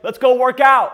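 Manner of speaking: loud, high eager call to the gym; roommate's eager call. Retold or imitated. Roommate urging his lazy friend: loud eager call. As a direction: pump him up to hit the gym. Roommate voiced calling him out.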